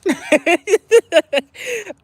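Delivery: cracking up at her own habit; high laugh. Real, not acted. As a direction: burst out laughing. Laughing at herself.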